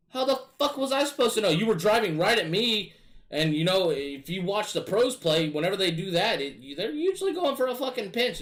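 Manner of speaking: high, loud frustrated shouting; exasperated yell. Retold or imitated. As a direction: yell at the teammate who wrecked the air dribble. Yelling at teammate in retold game.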